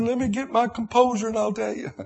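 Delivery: high, tearful plea for a moment; a son's mother fighting tears on the phone; holding back tears. Retold or imitated. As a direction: choke it back and ask for a minute. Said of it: "I said".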